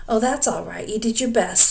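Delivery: ordinary delivery, nothing marked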